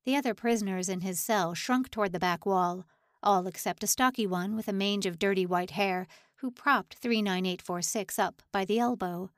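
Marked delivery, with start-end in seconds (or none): none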